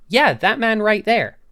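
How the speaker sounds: a high, loud "that man right there"; loud insistence. Retold or imitated. Shop assistant points at the customer, loudly insisting.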